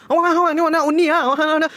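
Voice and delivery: panicked mother. Retold or imitated. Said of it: "dengan paniknya tuh".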